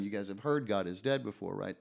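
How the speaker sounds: ordinary talk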